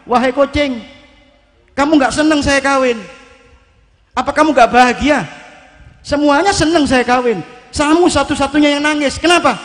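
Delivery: hammed-up furious big cat scolding; angry tiger. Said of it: "macan tadi lihat kucing, marah, Pak". (macan marah membentak kucing dengan suara tinggi dan keras)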